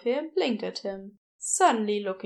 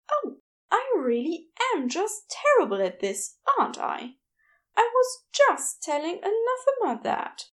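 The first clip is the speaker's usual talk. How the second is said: higher, gentle fussy Aziraphale voice, embarrassed; flustered angel; 11 semitones higher than usual. A narrator's reading. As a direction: play a flustered, apologetic angel.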